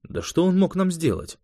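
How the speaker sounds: surprised, pitch jumping up. A narrator's reading. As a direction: lift the pitch in mild disbelief. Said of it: "Швед удивился".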